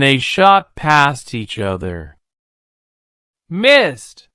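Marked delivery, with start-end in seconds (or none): 3.5-4.3 high yelled crowd voice; a crowd shouting at the fight; crowd yelling. A narrator's reading. Villagers yell at the missed charge, higher. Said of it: "yelled the crowd".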